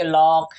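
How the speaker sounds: लॉक with a rounded AW; wrong aw version. Shown as a demonstration. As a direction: mispronounce lake as लॉक. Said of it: "इसको हम ये नहीं कहेंगे". (गोल ऑ से गलत "लॉक")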